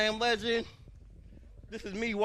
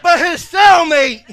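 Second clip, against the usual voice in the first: laughing, shouted punchline; crowd-pleasing yell; loud punchline shout; 6 semitones higher, 14 dB louder than usual. Real, not acted. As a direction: belt it out through laughter. Comedian hyping punchline, loud.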